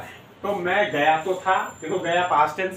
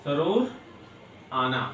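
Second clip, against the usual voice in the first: split slowly; 4 semitones lower, 6 dB quieter than usual. Shown as a demonstration.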